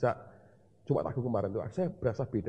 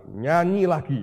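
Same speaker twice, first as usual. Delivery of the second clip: "Nyanyi lagi" said louder and higher, pressing the point; insistent command; 5 semitones higher, 9 dB louder, slower than usual. Retold or imitated. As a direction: repeat the order with force. God repeats command, insistent. ("Nyanyi lagi" diucapkan lebih keras dan tegas)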